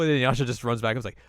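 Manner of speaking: ordinary talk, nothing marked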